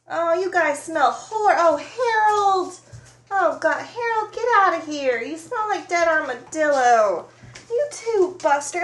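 loud, high scolding yell at the dogs; shooing the dogs. Real, not acted. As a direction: shout the smelly dogs out of the room. Dogs barge in smelling bad.

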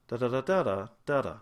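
da da da with the British lilt; RP tune. Shown as a demonstration. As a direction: hum the RP melody. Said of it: "that has a different song to it".

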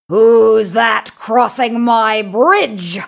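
loud, slow, drawn-out troll roar; booming monster challenge; troll's roar. A narrator's reading. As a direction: roar it out slowly as a big troll. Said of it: "he roared".

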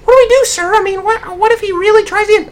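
squeaky high, panicky flunky asking sir; panicked underling. Acted. Flunky asks the boss what to do, high and panicky. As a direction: play a nervous henchman begging for orders.

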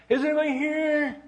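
high, loud holler; sing-out searching shout; calling out. Retold or imitated. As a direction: call out into an empty church. Calling into empty church.

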